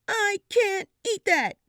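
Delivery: loud tearful protest; crying. A narrator's reading. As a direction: wail it like a sobbing child. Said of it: "I cried and cried and said".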